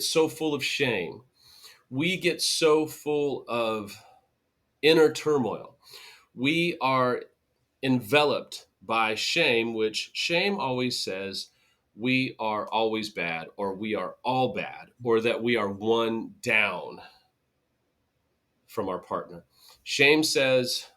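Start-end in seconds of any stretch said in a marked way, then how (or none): none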